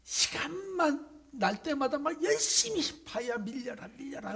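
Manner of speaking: high, slow, effortful voice; straining man. Acted. Sick man pushing the rock, high and straining. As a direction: push the rock with your voice.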